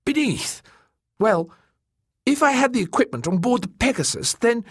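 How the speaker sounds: high, hesitant character voice; nervous mapmaker. Acted. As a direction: flounder for an answer in a high voice. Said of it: "licked his lips nervously".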